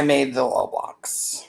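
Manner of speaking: whispered aside; breathy whisper. Real, not acted. Unvoiced aside.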